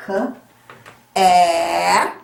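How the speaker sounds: K and A sounded out; sounding out letters. Shown as a demonstration. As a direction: sound out each letter clearly. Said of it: "short A".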